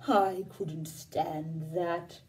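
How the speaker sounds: low, slow, lumbering cow. Acted. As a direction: speak like a worried old cow, dragging each word. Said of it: "asked the cow".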